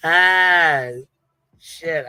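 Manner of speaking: ordinary talk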